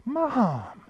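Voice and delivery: light up as you recognize her; surprised recognition. Retold or imitated. Son hearing his late mother on the phone, surprised joy.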